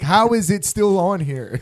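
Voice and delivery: high, loud, slow disbelief; mock exasperation. Acting someone stuck with an unwanted album, mock exasperation.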